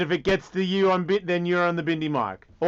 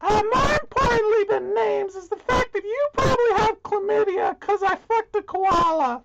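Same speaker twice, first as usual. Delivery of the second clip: very high, wailing character voice; squealing Bindi; 14 semitones higher than usual. Acted. Bindi confesses to Russell about the koala, high squeal.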